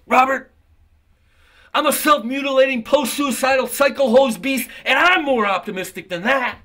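high, loud mock girl's voice; mimicking the student. Teacher retelling his student's quip, high loud mock voice. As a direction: throw it out high and brash like her. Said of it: "and she goes".

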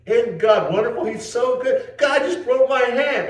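high, loud, thrilled gushing; excited friend. Retold or imitated. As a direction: imitate her overjoyed call, slowing at the end. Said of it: "she was excited".

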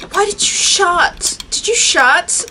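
upset. Real, not acted. Door shut on her.

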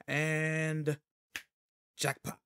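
usual speaking voice

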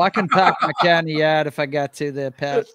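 loud New England accent. Shown as a demonstration. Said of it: "do a convincing New Englander accent".

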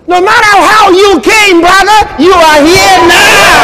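preacher crying out to the congregation; preaching at full force. Acted. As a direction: lift it to a ringing shout.

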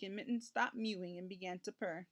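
ordinary delivery

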